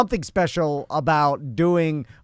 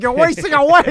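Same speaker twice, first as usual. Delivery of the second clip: high, louder alarmed imitation; Crystal; 10 semitones higher, 6 dB louder than usual. Runner imitates Crystal worrying about his weight, mock alarm. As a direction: quote her fretting, pitched up high. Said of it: "She's like".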